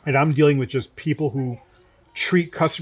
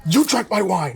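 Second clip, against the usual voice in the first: higher-pitched imitation of a furious customer; mock outraged accusation, pitched up; retold angry accusation; 5 semitones higher than usual. Furious diner accusing the sommelier over his wine, retold in mock outrage. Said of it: "his face was shaking".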